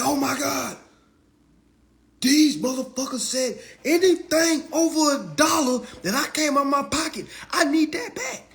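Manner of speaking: very high, loud comic rant; hammed-up outrage. Reader claiming money back over a dollar, hammed-up high outrage. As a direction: squeal it in exaggerated disbelief. Acts out reaction to the law.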